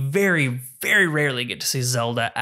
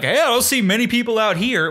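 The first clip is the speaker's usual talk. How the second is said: lantern guy voice; 9 semitones higher than usual. Retold or imitated. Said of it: "he's like".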